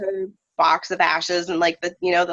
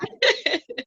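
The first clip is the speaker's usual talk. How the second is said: short high teary giggle; tearful little giggle; high laugh. Real, not acted. Laugh after friend agrees.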